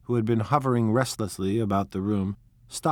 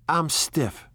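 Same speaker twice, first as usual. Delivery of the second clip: complaining; 6 semitones higher than usual. Acted. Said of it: "she complained".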